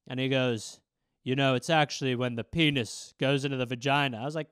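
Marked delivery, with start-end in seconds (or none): 1.2-4.2 a hint of low, earnest father; lightly voiced dad, lower; gentle dad. Dad explaining to his son, low.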